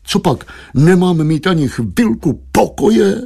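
drawn-out pleading protest; wailing husband. A narrator's reading. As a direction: whine for some peace, dragging the words. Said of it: "zakvílel obchodník".

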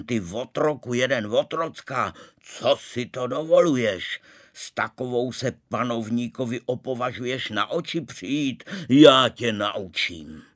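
loud, outraged storybook bellow; terrible roar. A narrator's reading. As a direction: roar insults like an enraged ruler. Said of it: "zařval takovým děsným hlasem".

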